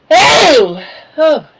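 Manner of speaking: loud startled oh. Real, not acted. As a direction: jump at the sudden noise. Startled by sudden cough.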